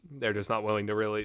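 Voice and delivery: ordinary talk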